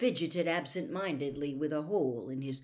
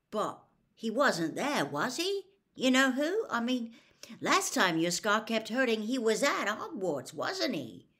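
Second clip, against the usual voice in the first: put-on higher, worried teenage boy; anxious Ron voice; 7 semitones higher, 4 dB louder than usual. A narrator's reading. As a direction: press him nervously.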